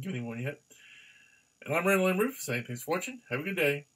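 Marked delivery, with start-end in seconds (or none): 1.6-3.9 announcer sign-off. Acted. Vlog outro sign-off.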